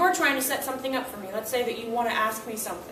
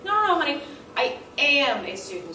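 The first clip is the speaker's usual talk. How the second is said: gentle correction; 2 semitones higher than usual. Retold or imitated. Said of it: "And I'll go".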